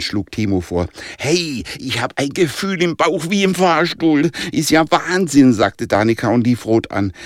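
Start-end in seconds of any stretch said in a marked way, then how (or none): 1.2-5.5 high-pitched exclamation all through; giddy alarm. A narrator's reading. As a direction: exclaim at the stomach-dropping ride, pitch held high. Girl reacts to stomach-dropping spin.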